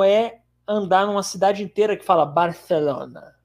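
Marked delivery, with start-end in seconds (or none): 2.3-3.3 "Barcelona" slow, with a lisped th for the c; "Barthelona", drawn out with a Castilian lisp; Spanish lisp. Shown as a demonstration. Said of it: "eu adoro os espanhóis falando Barcelona".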